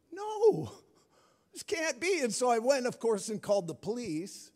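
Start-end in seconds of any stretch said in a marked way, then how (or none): 0.1-2.2 shocked father who finds his truck stolen; panicked disbelief. Retold or imitated. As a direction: retell the moment it all sank in, near yelling. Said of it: "I'm standing there freaking out".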